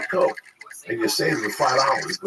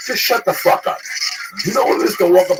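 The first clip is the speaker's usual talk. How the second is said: angry shout; 5 semitones higher, 6 dB louder than usual. Acted. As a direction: shut the fuck up shouted high and loud. Angry rant at critics.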